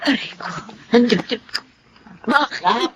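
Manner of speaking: pained. Acted. Chest pain before collapsing.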